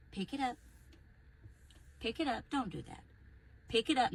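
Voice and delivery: soft, restrained parent instruction; gentle parenting. Acted. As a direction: keep it quiet and controlled with the child. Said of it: "to gentle parent".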